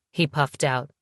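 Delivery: ordinary delivery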